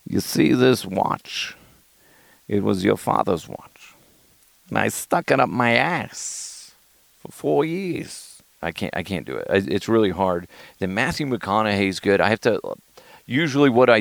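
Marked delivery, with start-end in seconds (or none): 0.1-8.2 slow, halting Walken cadence with long pauses; Christopher Walken. Acted. Comic reciting the watch speech, struggling Walken impression. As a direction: attempt a measured, oddly paused Walken. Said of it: "his cadence of Christopher Walken".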